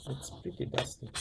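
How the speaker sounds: soft whisper under the breath; whispered aside. Real, not acted. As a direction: whisper it to yourself as a side note. Aside while handling dusty deck.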